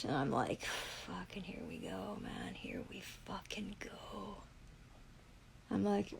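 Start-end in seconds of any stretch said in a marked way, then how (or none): 1.1-4.4 whispered aside to herself; under-breath whisper. Real, not acted. Hearing of a warrant, she whispers to herself in disgust.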